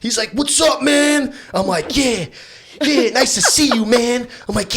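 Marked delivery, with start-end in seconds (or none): 0.3-1.3 much higher, louder dude voice; friend's bro voice. Retold or imitated. 2.0-4.3 high, loud breezy reply; casual bro reply. Retold or imitated. His reply acted, higher.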